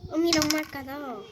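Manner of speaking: usual speaking voice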